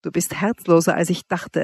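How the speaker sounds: ordinary delivery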